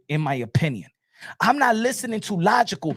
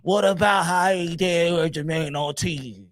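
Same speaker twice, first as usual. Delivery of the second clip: mocking the doubters; 2 semitones lower than usual. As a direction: mimic naysayers in a mushy whine. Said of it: "y'all motherfuckers just be like".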